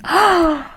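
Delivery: sharp offended gasp; mock gasp. Insulted by her man's offhand invite, a dramatic gasp. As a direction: gasp like she's been insulted.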